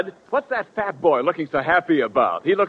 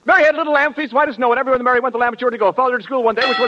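rushed recital; 7 semitones higher, much faster than usual. Acted. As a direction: race through it to win. Speed-reading contest, announcer.

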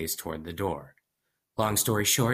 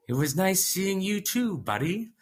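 forced friendly; 11 semitones higher than usual. Acted. Narrator fakes friendliness.

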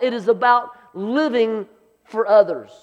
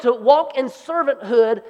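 usual speaking voice

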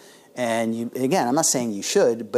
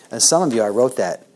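usual speaking voice

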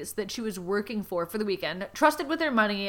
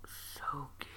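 whisper; 9 semitones lower, 21 dB quieter than usual. Acted. Whispered word in reading.

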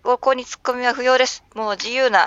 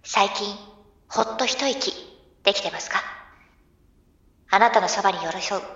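gentle, soft ad voice; soft commercial read; 3 dB quieter than usual. Shown as a demonstration. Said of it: "あー、噛んだ。".

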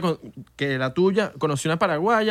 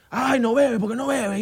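higher, mocking nosy voice; mock whiny questioning, higher; nosy people; 6 semitones higher, slower than usual. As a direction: mimic pushy partygoers, pitched up. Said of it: "me imagino la gente".

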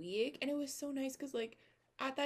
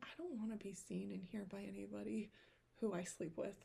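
whisper; 8 dB quieter than usual. Retold or imitated. Inner thought whispered.

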